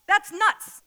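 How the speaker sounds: loud, amused disbelief aimed at the congregation; incredulous outburst. Real, not acted. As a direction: blurt it out in amazement. Preacher reacting to mind reading.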